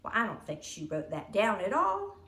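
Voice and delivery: plain reading voice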